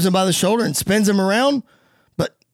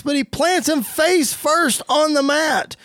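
higher, punchy play-by-play; wrestling announcer's rising excitement; excited move call; 8 semitones higher, slower than usual. Acted. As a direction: sell the slam from ringside. Calls a big wrestling move.

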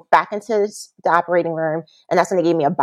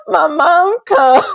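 laughing through it; 11 semitones higher, 4 dB louder, much slower than usual. Real, not acted. Laughing while telling the story.

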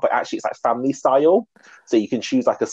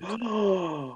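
shocked gasp; 10 semitones higher, 7 dB quieter than usual. Real, not acted. Reacting to a huge price.

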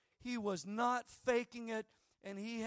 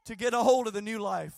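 building into a loud, high shouted plea; pleading declaration; 5 semitones higher, 12 dB louder than usual. Acted. Believer crying out to Jesus for change, loud pleading shout. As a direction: cry out that you want it, louder each line. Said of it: "step up to the plate and say".

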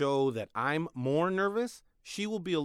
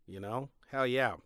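ordinary talk, nothing marked

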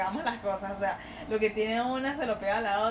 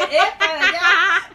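much louder, slow, laughing through the quote; laughing mock imitation; 8 semitones higher, 12 dB louder, slower than usual. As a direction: imitate him pointing the way, cracking up. Imita al chico señalando. (imitación burlona, mucho más fuerte y entre risas)

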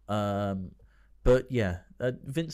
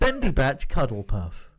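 laughing repeat; 6 dB louder than usual. Retold or imitated. Repeats parody name, laughing.

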